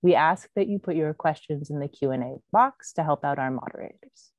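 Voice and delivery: ordinary talk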